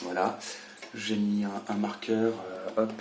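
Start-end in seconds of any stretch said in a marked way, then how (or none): none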